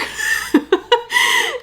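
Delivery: sudden, high-pitched laugh; real high laugh. Real, not acted. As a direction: burst into a squeaky laugh. Laughs at own remark.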